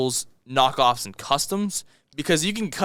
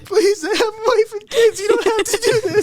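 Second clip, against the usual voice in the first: mock pleading; 5 dB louder than usual. Mock hostage begging.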